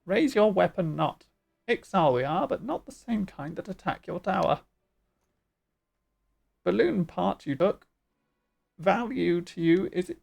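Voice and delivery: loud, choppy bird-creature voice. Acted. Bird chief sizing up the stranger, squawky. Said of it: "doing the bird voice".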